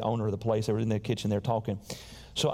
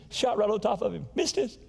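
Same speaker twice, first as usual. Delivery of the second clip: even higher teasing jab, rubbing it in; sing-song it to rub the miss in; 9 semitones higher than usual. Real, not acted. Teasing brother who missed deer.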